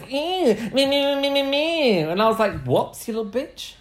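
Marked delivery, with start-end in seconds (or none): 0.1-2.0 very high, campy nonsense squeal; niece's mocking impression. Host retells niece's insulting impression of him, campy. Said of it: "does an impression of me". 2.7-3.7 mock-offended retort. Retelling his retort to niece.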